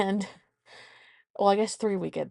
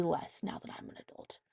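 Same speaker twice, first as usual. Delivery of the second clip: whispered joking aside; 2 semitones lower, 12 dB quieter, faster than usual. Real, not acted. Mother to listeners, whispering a wry aside. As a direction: drop to a whisper for the quip.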